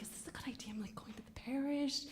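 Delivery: anxious whispered self-talk; nervous whisper. Retold or imitated. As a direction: whisper the doubts to yourself in the car. Nervous inner thoughts driving over.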